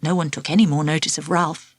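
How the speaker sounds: usual speaking voice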